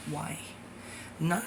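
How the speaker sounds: ordinary talk, nothing marked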